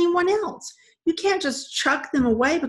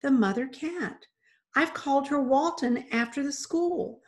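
put-on higher, earnest young girl; rushing on earnestly; 5 semitones higher than usual. A narrator's reading. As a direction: explain with pleading insistence. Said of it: "Mandy rushed on".

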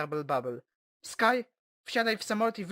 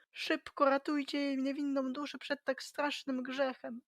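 fussy mayor; 6 semitones higher than usual. Acted. Mayor figure voiced.

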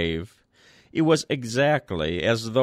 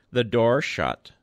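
usual speaking voice

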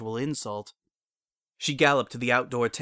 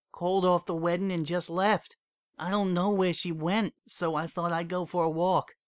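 numb, like nothing matters anymore; cold and flat; 7 semitones higher than usual. A narrator's reading. Heartbroken mare tells her friend Lyra left, cold and flat.

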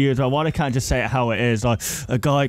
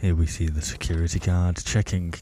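lower-pitched documentary-style commentator; mock commentating; 5 semitones lower than usual. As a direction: narrate the game like a commentator. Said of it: "So I'll be doing the commentating".

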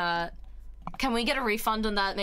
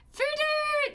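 excited squeal. Real, not acted. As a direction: squeal a viewer's name in delight. Greeting a viewer.

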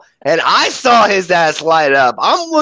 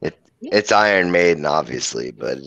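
mock doom voice; 13 semitones lower, 5 dB quieter than usual. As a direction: pronounce it like a grim prophet of doom. Mock gloomy verdict on the vote.

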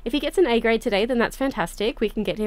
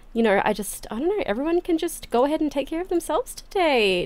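ordinary delivery

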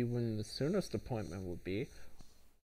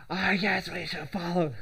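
loud stage whisper, half shouted; hiss-shout it to your friend; whisper yelling; 5 semitones higher, 6 dB louder than usual. Pretend. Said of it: "whisper yell".

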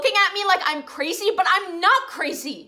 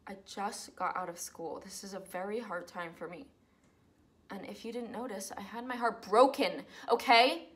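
soft, hurt and close to tears; wounded plea; 7 semitones lower, 5 dB quieter than usual. Acted. To her roommates, a hurt, subdued protest. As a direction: hold back tears while defending yourself.

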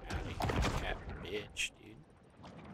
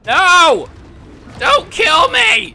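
real panicked scream, much higher and louder; 20 dB louder, much slower than usual. Real, not acted. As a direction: scream for your life at the screen. Panicked as shark attacks him.

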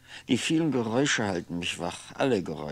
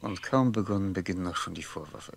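ordinary talk

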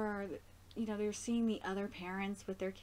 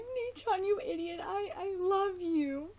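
Taka's little voice; 9 semitones higher than usual. Retold or imitated. Retelling Taka's words to his brother, a high teary kid voice. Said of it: "Taka's like".

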